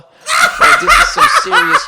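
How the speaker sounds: high squealing laugh through the words; cracking up too hard to finish; loud, high, laughing fit. Real, not acted. Laughing mid-quote.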